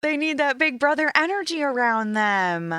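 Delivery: high, fast whiny parent voice; mock worried parent. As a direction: ham up the panicking parents. Mimicking parents' whiny worry.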